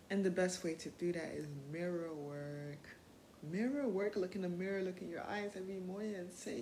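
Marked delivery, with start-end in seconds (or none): none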